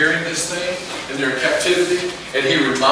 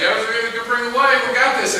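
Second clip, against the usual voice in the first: higher, cocky crowd voice; hyped up; 8 semitones higher than usual. Retold or imitated. Hyped-up people after a great sermon, higher voice. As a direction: sure of themselves, pumped. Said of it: "everybody's hyped up on this thing".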